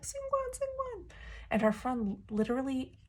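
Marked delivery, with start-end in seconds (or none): 0.0-1.1 imitated excited yell. Fan alerting her friend to the card, mock squealed yell. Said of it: "is yelling at her friend".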